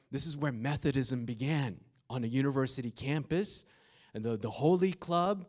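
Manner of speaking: awed preacher lifting the crowd; excited preaching. Real, not acted. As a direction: build the wonder of the moment.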